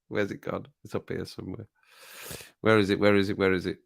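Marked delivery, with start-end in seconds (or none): 0.1-1.7 whispering. Real, not acted. Looking for comment.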